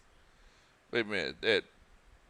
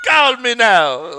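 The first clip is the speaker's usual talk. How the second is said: loud, high, drawn-out psychic hotline pitch; laughing, hammed-up mock TV psychic; Miss Cleo; 11 semitones higher, 15 dB louder, slower than usual. Host teasing co-host's bad accent, mock psychic call. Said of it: "it sound like Miss Cleo".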